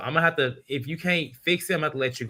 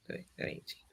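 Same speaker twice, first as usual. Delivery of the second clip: whispered aside; 17 dB quieter, faster than usual. Real, not acted. Trailing aside to himself.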